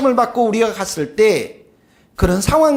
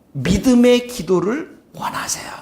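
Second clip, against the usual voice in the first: slow hushed whisper. Real, not acted. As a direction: whisper it tenderly, slowly. 설교 중 속삭임. (천천히 속삭이듯)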